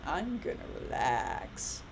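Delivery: a hopeful mom with her coffee; wishful relaxed sigh. Retold or imitated. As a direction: savour the moment you think you'll rest. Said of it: "I'm standing there with my cup of coffee going".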